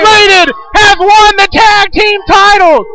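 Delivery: slow, high, loud title announcement; excited shout. Acted. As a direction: belt out the champions.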